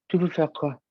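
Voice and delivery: lightly voiced acting teacher asking; teacher's voice. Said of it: "c'est mon professeur qui parle".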